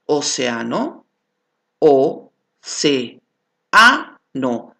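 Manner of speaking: océano then each vowel said apart; syllable split demo. Shown as a demonstration. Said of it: "cuando he realizado el silabeo".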